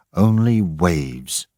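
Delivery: ordinary delivery, nothing marked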